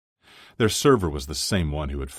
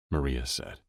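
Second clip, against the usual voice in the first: ordinary delivery, nothing marked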